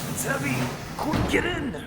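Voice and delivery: loud, high, squeezed out under the weight; strained yell. Acted. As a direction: shout while holding the door up. Holding up a heavy door.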